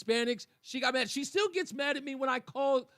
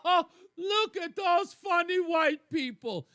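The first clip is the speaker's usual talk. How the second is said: higher, slow, laughing taunt; mocking laugh; 8 semitones higher, 5 dB louder than usual. Host mocking laughing listeners, higher and slow. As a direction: laugh it out smugly. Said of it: "You're going to laugh".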